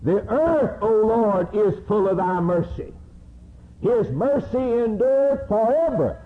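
high, lifted chant of scripture; preacher intoning psalms; psalm recitation. Acted. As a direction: sing out the verses in praise.

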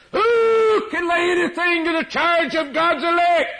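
high, loud shouted challenge from the pulpit; full-force preaching. Acted. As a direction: cry it out as a defiant challenge.

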